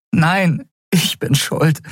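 high, insistent protest; distressed self-blame. Acted. Endriel takes the blame from Kai, distressed. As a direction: protest it, pitched well up.

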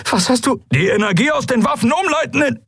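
very high, panicked battle cry; frantic urgency. Acted. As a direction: shout it as the hit is coming. Panicked exchange before the impact.

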